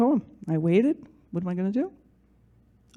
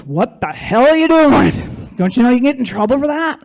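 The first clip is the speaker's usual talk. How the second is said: acting out a shouted telling-off; retold scolding; 4 semitones higher, 7 dB louder than usual. Mailman scolding her for what she's doing, loudly.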